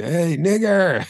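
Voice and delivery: high, slow drawn-out mock yell; retold slow yell. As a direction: replay a taunt shouted from a car. Drunk kids yelling from car.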